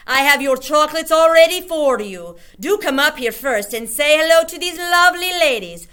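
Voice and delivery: high, loud syrup-sweet witch; sugary witch voice. A narrator's reading. Grand High Witch luring Bruno onto the platform. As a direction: drip with false sweetness. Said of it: "Her voice was quite different now".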